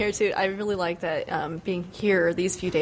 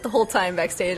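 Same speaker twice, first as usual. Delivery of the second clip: ordinary talk, nothing marked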